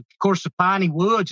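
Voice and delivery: ordinary talk, nothing marked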